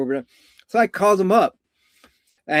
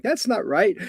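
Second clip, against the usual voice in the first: high-pitched mock objection; quick, raised-voice imitation of a doubter; puzzled objector; 8 semitones higher than usual. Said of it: "you who are wondering".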